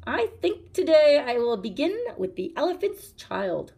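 between his teeth. A narrator's reading. Crocodile gloating over the child through his teeth. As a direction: grind it through shut teeth. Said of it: "he said it between his teeth like this".

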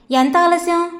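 usual speaking voice

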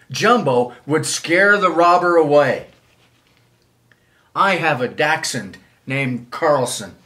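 plain reading voice

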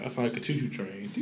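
ordinary talk, nothing marked

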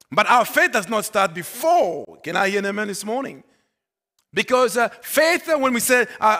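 preaching. Acted. Pastor challenging when faith starts, preaching. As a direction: push the point hard at the congregation.

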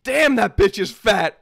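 slow, loud, blunt remark. Retold or imitated. At his cousin's school play, a slow loud blurt. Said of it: "I say that right out loud".

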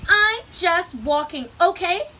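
high, loud, emphatic retort; exasperated shout. A narrator's reading. Grumpy Bird answering Fox, shouting exasperatedly. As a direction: shout it back, fed up. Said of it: "shouted Bird".

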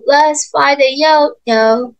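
very slow, sing-song for toddlers; reading to a toddler. Shown as a demonstration. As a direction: stretch it out for small kids.

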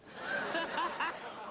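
mock belly laugh at the boss; big belly laugh. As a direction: laugh it up at his joke. Big laugh at boss's joke.

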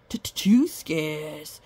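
low, slow stutter on the opening sound; stammering blacksmith. A narrator's reading. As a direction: stumble over the word, rattled. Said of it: "stammered the blacksmith".

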